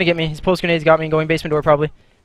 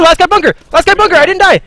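combat callout; 10 semitones higher, 6 dB louder than usual. Real, not acted. Clutch callout during the round.

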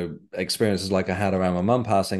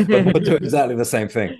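louder, higher, laughing through the words; laughing while talking; 7 semitones higher, 6 dB louder than usual. Real, not acted. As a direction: laugh it off as you admit it. Laughs at own hypocrisy.

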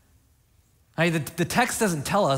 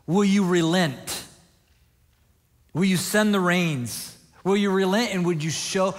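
an earnest plea, slightly raised in pitch; pleading; 3 semitones higher than usual. Acted. Preacher voicing the mother praying to God for rain, pleading. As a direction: plead for relief, pausing between questions. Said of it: "She's just pleading with God".

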